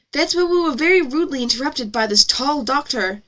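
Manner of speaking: ordinary talk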